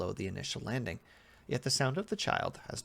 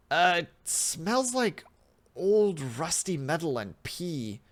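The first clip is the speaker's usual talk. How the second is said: slow, higher, louder grossed-out voice; disgusted description; 6 semitones higher, 6 dB louder, slower than usual. Acted. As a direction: drag out the words in disgust. Subject describes a foul smell.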